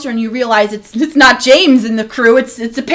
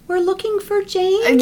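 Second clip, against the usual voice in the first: put-on higher, drawn-out timid girl; mousy little-girl voice; 4 semitones higher, 7 dB quieter, slower than usual. Unseen girl calling from the dark woods, imitated mousy voice. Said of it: "this little mousy voice, this little girl voice".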